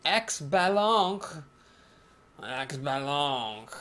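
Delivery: bad mock French accent, slow and drawn out; terrible French accent. A streamer hamming up a bad French accent, slow. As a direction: ham up a French announcer, badly. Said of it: "that was a terrible French accent".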